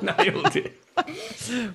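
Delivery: laughing it out, slow and high. Real, not acted. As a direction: giggle the words out proudly. Laughing after her attempt.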